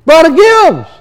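high, slow call across a crowd; high drawn-out calling voice. Retold or imitated. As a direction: hail a friend from a distance. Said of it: "he hears this voice".

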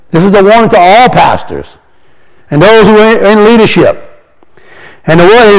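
ordinary delivery

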